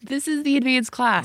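high, loud, scandalized confirmation; incredulous emphasis. Real, not acted. Mom confirming to friend it's advanced class, loud disbelief. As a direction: hammer it home in disbelief.

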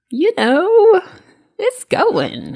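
high, loud, sing-song brush-off; weary shrug. Retold or imitated. Telling the mail clerk how her day is, breezy deflection. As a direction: toss it off like nothing's wrong. Answering the postman about her dog.

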